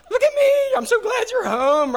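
high, loud squeaky dog talk; dog overjoyed the owner is home, very high; excited dog voice. Retold or imitated. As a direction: gush like a thrilled pup.

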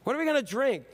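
high-pitched whiny complaint; complaining demand. Acted. Crowd complaining to Moses about water, high whine. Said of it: "They complained and turned to Moses".

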